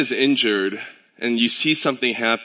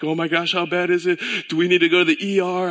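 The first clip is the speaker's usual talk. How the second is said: higher, fast, rising alarm; retold freak-out; 7 semitones higher, faster than usual. Father retelling his fear about his daughter's finger, higher and fast. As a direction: rush the questions in mock panic. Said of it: "I'm freaking out".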